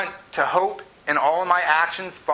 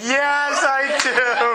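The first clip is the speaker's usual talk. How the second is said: eager yes; 8 semitones higher, 3 dB louder, much slower than usual. Retold or imitated. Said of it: "I'm like".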